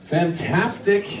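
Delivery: normal talking voice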